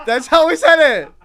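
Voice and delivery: high, loud protest through laughter; laughing protest. Real, not acted. Defending his impression to a teasing friend, laughing protest. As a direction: insist while cracking up.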